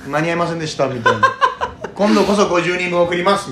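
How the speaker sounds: laughing. Retold or imitated. Retelling listener DM, laughing.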